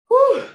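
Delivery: sharp high, loud whew to stop the tears; emotional exhale. Real, not acted. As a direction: blow it all out to keep from crying. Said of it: "I gotta break that 'cause I don't wanna get too emotional".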